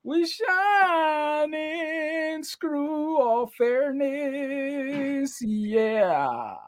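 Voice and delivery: very high, slow falsetto. Pretend. High put-on voice.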